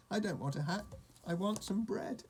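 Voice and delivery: high-pitched fussy demand; indignant insistence. A narrator's reading. Hard-of-hearing sheep to shopkeeper, fussy indignation.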